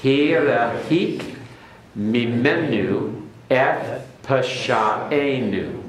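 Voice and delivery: slow Hebrew, each word set apart; careful teacher's Hebrew recitation; Hebrew read aloud. Shown as a demonstration. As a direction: model the line for the class. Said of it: "everyone repeat it after me".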